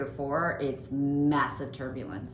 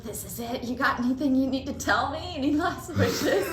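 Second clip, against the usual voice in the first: high, urgent, building to louder; mock doom; 9 semitones higher, 3 dB louder than usual. Teasing anxious friend during turbulence, hammed-up doom. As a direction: play up the doom for shock value. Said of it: "anything for shock value".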